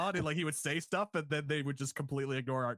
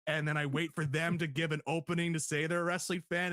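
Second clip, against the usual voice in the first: ordinary talk, nothing marked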